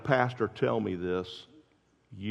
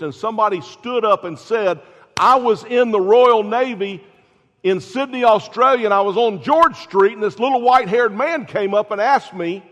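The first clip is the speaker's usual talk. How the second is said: climbing, much higher and louder preacher's build; preaching at full force; 11 semitones higher, 12 dB louder than usual. Acted. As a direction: drive the story up to a shouting peak.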